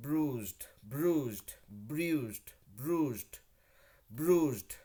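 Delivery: Bruised with rounded lips, ending in a voiced zd; lip-rounded pronunciation. Shown as a demonstration. Teacher shows lip movement for English words, careful and rounded. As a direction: shape Bruised with clear lip movement, brooz-d. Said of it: "lip movement भी बहुत बड़ा role play करता है".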